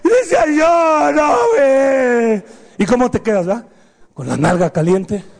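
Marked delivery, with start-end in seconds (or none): 0.5-2.4 long, stretched-out mock wail; wailed denial. Child still denies it to mom, drawn-out wail. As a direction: drag out the protest like a crying kid. Child wailing after the blows.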